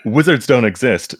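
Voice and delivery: "Wizards don't exist" said steadier and falling, laugh held back; composed indignant read. Acted. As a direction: keep a straight face, then read it indignantly. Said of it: "get through that line without laughing".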